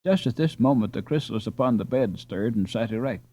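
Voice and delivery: usual speaking voice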